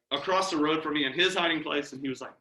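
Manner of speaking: ordinary delivery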